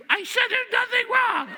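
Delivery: hammed-up high, loud screech; imitation of a wife shrieking in a quarrel. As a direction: screech it in mock tearful fury. Retold quarrel, crying wife.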